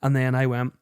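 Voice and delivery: normal talking voice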